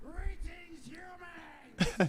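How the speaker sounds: loud, slow robotic greeting; old speech synthesizer. Pretend. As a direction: announce it like a creepy machine. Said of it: "I am Danhausen".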